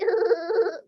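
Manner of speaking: loud pretend boo-hoo; exaggerated childlike crying noise; mock sobbing. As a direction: sob theatrically mid-complaint. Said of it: "Sedihnya kenapa coba?".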